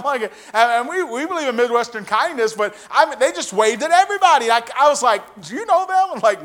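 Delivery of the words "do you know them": loud, very high incredulous asking; puzzled question. Retold or imitated. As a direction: squeak it up in mock bafflement. Acts out his puzzled question.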